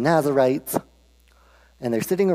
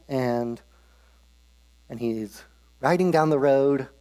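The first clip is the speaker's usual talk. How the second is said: ordinary delivery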